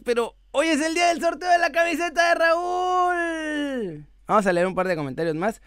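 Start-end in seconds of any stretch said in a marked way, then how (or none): 0.0-4.1 high, slow announcer shout; excited shout. Real, not acted. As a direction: hype up the giveaway. Announces the shirt giveaway. (grito emocionado de anuncio)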